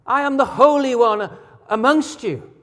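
high-pitched, emphatic proclamation as God; God declaring. Retold or imitated. Preacher voicing the Holy One among his people, high and emphatic. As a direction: declare it with a preacher's full conviction. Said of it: "he says".

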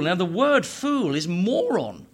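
normal talking voice

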